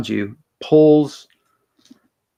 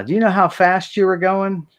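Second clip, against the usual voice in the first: higher, stern traffic cop question; 8 semitones higher than usual. Acted. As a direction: play the cop pulling him over. Cop questions driver in joke.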